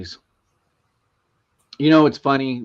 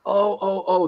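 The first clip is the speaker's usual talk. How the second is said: higher-pitched string of realization; dawning realization; 7 semitones higher than usual. Retold or imitated. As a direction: imitate a teller suddenly catching on. Acting out teller finally getting it.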